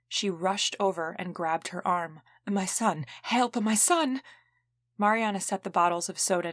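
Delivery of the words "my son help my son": put-on frantic plea in a thick Italian accent, rising higher. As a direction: beg for help for her son, Italian accent. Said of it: "in a thick Italian accent".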